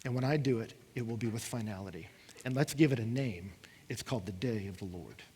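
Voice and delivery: low, soft, grave divine voice; voice of God. Retold or imitated. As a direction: speak as God, quietly final. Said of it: "And God tells them".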